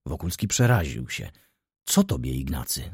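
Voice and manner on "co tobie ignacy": usual speaking voice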